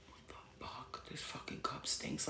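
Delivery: whispered private thought; inner whisper. Retold or imitated. Whispering to herself about the friend's smelly cup. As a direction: whisper it under your breath so she can't hear. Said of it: "Like, in my head".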